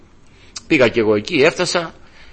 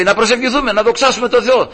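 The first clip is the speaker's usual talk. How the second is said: lightly imitated uncle, pitched high; insistent uncle; 8 semitones higher than usual. Uncle calling his brother to prayer, high imitated urging. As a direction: keep urging in a raised voice.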